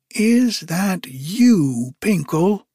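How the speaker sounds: high, drawn-out call across the water; crying out. A narrator's reading. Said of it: "cried she".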